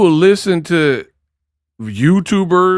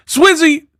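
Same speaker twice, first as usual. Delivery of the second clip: hype ad-lib; 13 semitones higher than usual. Pretend. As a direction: yell the tag like a producer drop.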